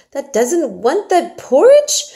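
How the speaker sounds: high, loud and slow in disbelief; can't believe she won't eat it; incredulous exclaim. A narrator's reading. Martha gasps at Mary refusing porridge, incredulous. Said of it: "Martha exclaimed incredulously".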